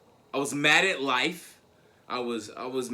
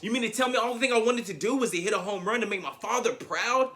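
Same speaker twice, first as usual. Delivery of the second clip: ordinary talk, nothing marked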